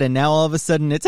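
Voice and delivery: ordinary delivery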